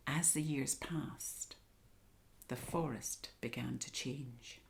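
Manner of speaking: soft, breathy storyteller hush; hushed whisper. A narrator's reading. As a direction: whisper the years passing. Story turns dark.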